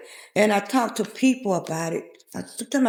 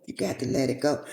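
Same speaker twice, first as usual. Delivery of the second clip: deeper echo of God; 8 semitones lower, 4 dB quieter than usual. Retold or imitated. God repeating "You got to let it go" to her, in a deep voice. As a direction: lay "You got to let it go" down low like a voice from above. God's words repeated, lower.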